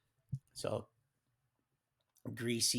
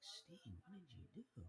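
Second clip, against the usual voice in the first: much softer, half-whispered ask; hushed question; 4 semitones higher, 20 dB quieter than usual. Retold or imitated. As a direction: lean in and ask it under your breath. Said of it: "he's looking at me like".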